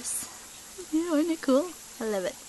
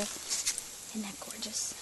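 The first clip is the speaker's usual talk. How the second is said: whispering; 2 semitones lower, 13 dB quieter, much slower than usual. Real, not acted. Admiring a lantana.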